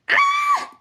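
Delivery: very high shriek of joy; excited squeal. Real, not acted. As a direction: squeal with delight. (petit cri suraigu de joie)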